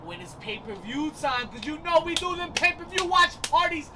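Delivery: punchy promo pitch, rising loud; hype ad read. Acted. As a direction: sell the bar like a radio spot. Hypes their bar like an ad.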